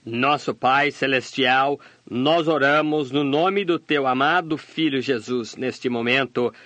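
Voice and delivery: ordinary delivery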